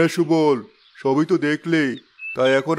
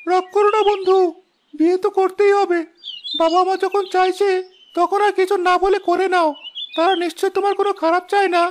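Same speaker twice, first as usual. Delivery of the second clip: much higher, cartoonish sidekick voice, gently coaxing; high-voiced loyal friend. Friend Subal soothing the sulking prince about his wedding, high coaxing voice. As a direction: play the cheerful young companion in a squeaky high register.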